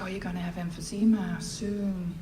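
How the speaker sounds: slow, soft, preachy mock warning; lightly mocking nag; mocking lecture. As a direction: parody a scolding worrier, slowly.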